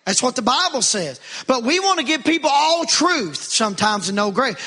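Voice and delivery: ordinary delivery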